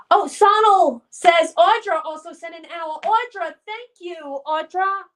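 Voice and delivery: high, excited gift announcement; delighted thanks. Real, not acted. Surprised by another viewer's gift.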